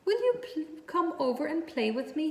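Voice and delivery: high, small pleading voice; pleading pig voice. A narrator's reading. Lonely pig begging the goose to play, high and pleading. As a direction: beg like a lonely little pig. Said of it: "he asked".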